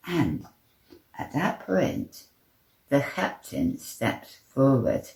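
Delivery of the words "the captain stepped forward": usual speaking voice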